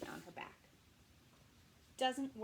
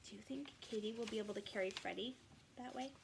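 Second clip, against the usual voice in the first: fast whispered aside; 6 dB quieter, faster than usual. Real, not acted. Reader whispering a question to the children. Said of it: "reader asks children aside".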